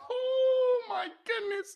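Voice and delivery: high laughing gasp; breathless giggle. Real, not acted. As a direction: laugh it out in disbelief. Laughing at the clip.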